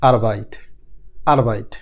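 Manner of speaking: আরবাইট said the German way, starting with আ; teacher modelling আরবাইট for learners; German word. Shown as a demonstration. German Arbeit spoken. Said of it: "এই দেশে বলা হয়".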